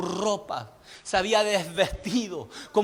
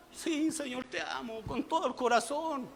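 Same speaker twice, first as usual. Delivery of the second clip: very soft acted prayer; quiet, sincere declaration to the Lord; humble avowal; 13 dB quieter than usual. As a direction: drop to a hushed, heartfelt reply. Acting believer's answer to God.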